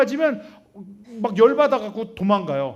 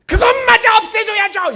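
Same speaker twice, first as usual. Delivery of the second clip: demanding complaint; 13 semitones higher, 10 dB louder than usual. Retold or imitated. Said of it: "이러죠".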